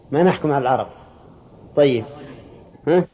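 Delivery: ordinary delivery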